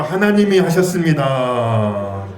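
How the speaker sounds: loud, slow, drawn-out declaration; call-and-response lead. Shown as a demonstration. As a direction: proclaim it slowly for the congregation to repeat. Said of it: "우리 좀 따라합시다". (따라 하도록 크고 느리게 선포)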